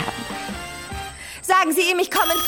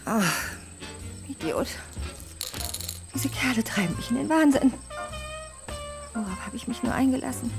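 soft exasperated grumbling to herself; weary self-talk; 12 semitones higher, 11 dB quieter than usual. Acted. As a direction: let it out under your breath, fed up. Alone after angry phone call.

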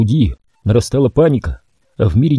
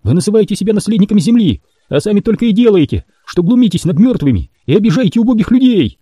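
fierce accusation; 9 semitones higher than usual. A narrator's reading. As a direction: throw the charge in their faces. Temple accuses.

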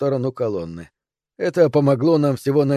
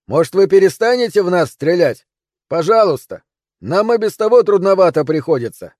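higher, louder exasperated plea; shouted complaint; 5 semitones higher, 5 dB louder than usual. Acted. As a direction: yell up to the people shooting. Climber yells up at attackers. (возмущённый крик наверх)

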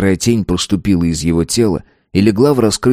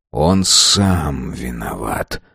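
lower, slow, weary words; quiet sigh; 6 semitones lower, much slower than usual. A narrator's reading. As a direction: say it with a tired sigh. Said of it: "с тихим вздохом". (с тихим вздохом)

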